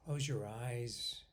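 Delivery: ordinary talk, nothing marked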